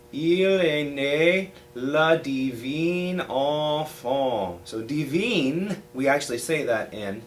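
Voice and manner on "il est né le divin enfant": very slow French, enfant nasal with no N; slow French demo. Shown as a demonstration. Teacher repeats the line for students, very slow and exact. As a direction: stretch out each syllable for the class. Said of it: "So let me say that again".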